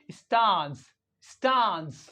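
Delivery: STANCE as 'stahns', long open vowel; British long A. Shown as a demonstration. Teacher modelling British English, long-ah STANCE. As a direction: say stance with the A of father. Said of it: "The same way we pronounce".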